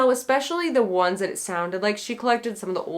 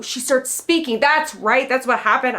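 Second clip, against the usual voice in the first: normal talking voice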